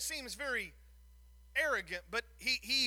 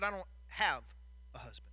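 curt, quieter, head-hung answer; clipped and short; 6 semitones lower, 5 dB quieter than usual. Acted. Ashamed woman answering Jesus curtly, clipped. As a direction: bite it off short and low. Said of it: "in a very clipped and short and cursed manner".

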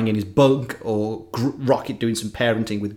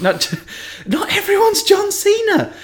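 high-pitched playful objection; jokey, high exasperated retort; mock protest. Real, not acted. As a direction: push back in a squeakily raised voice. Protests co-host's guess of John Cena.

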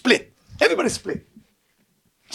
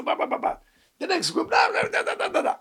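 mocking blah blah; 13 semitones higher, 4 dB quieter than usual. Mocking political groups' speeches.

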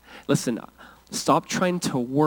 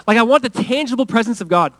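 normal talking voice

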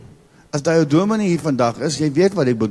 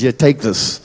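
curt prophet; 3 semitones lower, 5 dB louder than usual. Retold or imitated. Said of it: "the prophet will give you a disprin and says".

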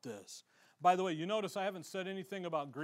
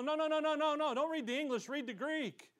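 much higher, rapid no no no; urgent protest; 8 semitones higher than usual. Retold or imitated. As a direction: stop him mid-read, insistent. Correcting the guy urgently.

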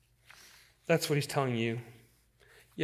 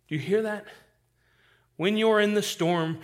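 ordinary talk

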